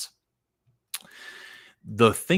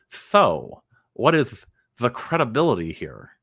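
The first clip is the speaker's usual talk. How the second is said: Spanish lisp; 3 semitones higher than usual. Retold or imitated. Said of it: "That's how Spanish people talk".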